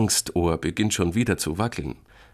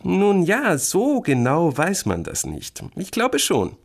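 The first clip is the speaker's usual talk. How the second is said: high, drawn-out evasive answer; stall, stretching the words up high; hedging; 12 semitones higher, 4 dB louder, slower than usual. A narrator's reading. Sister dodges whether creature is real, hedging. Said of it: "Penny greift sich an die Nase".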